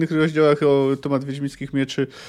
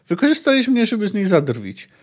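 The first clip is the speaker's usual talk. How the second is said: higher, pained accusation that eases; bitter reproach; 6 semitones higher than usual. Acted. Mozaik reproaching Geralt, bitter and upset. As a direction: let the hurt spill out.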